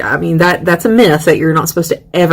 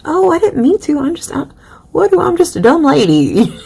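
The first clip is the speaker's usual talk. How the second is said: high, mock-helpless apology; feigned innocence; 9 semitones higher than usual. Poet excusing herself after an insult, feigned innocence. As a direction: play innocent in a raised, halting voice.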